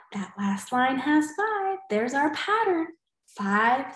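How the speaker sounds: normal talking voice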